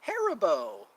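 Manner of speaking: a hint of the Haribo ad; imitating the ad. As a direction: lightly mimic the kid-voice commercial. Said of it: "commercial where the adults have kid voices".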